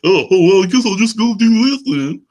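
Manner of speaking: high, loud caricature of a nagging wife; mocking wife voice. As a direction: put on the exaggerated Marge Simpson-like voice.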